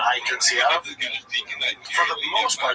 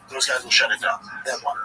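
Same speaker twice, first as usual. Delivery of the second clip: ordinary talk, nothing marked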